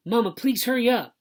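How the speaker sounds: put-on high, loud kid plea; high-pitched urgent call; urgent pleading. A narrator's reading. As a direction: beg loudly for haste. Kid urging mother to hurry.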